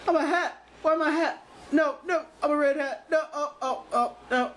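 high panicked voice. Acted. Panicking about being hat.

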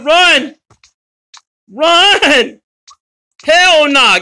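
loud, high cry to run; urgent shouting. Real, not acted. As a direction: shout for them to flee. Urging escape during the chase.

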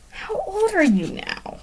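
high-pitched eager character question; lightly acted excited babble; babbling. A narrator's reading. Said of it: "Ron babbled on".

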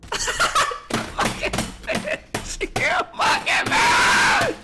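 very high, screeching laugh-yell; laughing shriek. Real, not acted. Shot by an angry player, shrieking with laughter.

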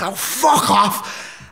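very slow, drawn-out scornful retort; dismissive. Retold or imitated. Son sneering back at shouting mum. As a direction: brush her off with contempt.

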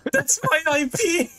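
high pitched panicked cry; shocked shriek. Real, not acted. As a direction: squeal it in disbelief. Panicking as IP appears.

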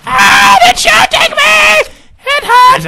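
put-on piercing falsetto child shrieks; screaming son. Son being shot, shrieking to Dad in agony. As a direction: shriek in pain like a panicked kid.

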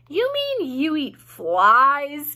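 slow, astonished gasp in a piglet voice. A narrator's reading. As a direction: gasp it out slowly, shocked. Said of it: "gasped Wilbur".

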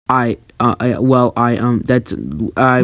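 halting, embarrassed hesitation; flustered stammer. Acted. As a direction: caught out and fumbling for words. Thomas caught out, flustered.

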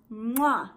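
loud smacking air kiss; blowing a kiss to her friend Lucy; kiss sound. Real, not acted. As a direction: blow a big kiss.

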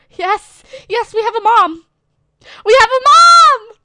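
high, loud cheering; celebrate, building to a shout; triumphant. Real, not acted. Streamer's avatar has found a mom, triumphant cheering. Celebrates getting adopted in game.

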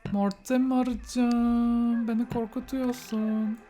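slow, drawn-out mock fright at a goth; mock spooked. Player teasing a goth Sim, drawn-out mock fear. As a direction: stretch the name out, playfully spooked. Talking to spooky Sims character.

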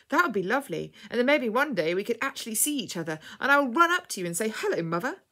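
put-on thrilled boy voice, swooping up with joy; excited little boy. A narrator's reading. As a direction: tumble on eagerly like a delighted small lad. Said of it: "said Freddie excitedly".

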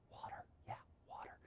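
breathy stage whisper, barely voiced; dramatic whisper. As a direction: let the secret slip out in a hush. Dramatic secret reveal to kids.

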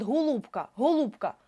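ГОЛУБКА with first О close to У; О наближене до У. Shown as a demonstration. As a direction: blur the unstressed О of ГОЛУБКА toward an У. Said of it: "О може бути наближене до У". (ГОЛУБКА майже як «гулубка»)

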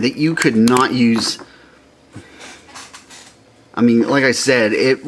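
normal talking voice

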